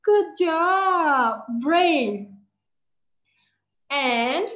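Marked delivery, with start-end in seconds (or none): 0.0-2.3 brave said loud; "brave" louder and falling; model word brave. Shown as a demonstration. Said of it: "Can you do it like this and then say brave?".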